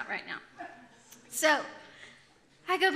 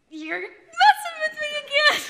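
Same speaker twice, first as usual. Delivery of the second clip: high, loud laughing protest; disbelieving outburst; 13 dB louder than usual. Retold or imitated. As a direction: burst out in retold disbelief. Retold shock at twins news.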